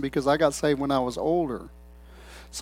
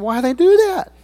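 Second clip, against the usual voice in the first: high, loud incredulous question; puzzled disbelief; 12 semitones higher, 5 dB louder than usual. Retold or imitated. Young believer thinking about preachers' put-on voices, baffled. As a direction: wonder aloud in a high, baffled voice.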